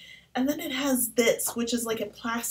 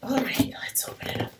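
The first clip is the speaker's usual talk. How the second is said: hushed whisper before opening; 2 semitones higher than usual. Real, not acted. Starting to unwrap for viewers, whispering. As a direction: keep it secret and breathy. About to open the package.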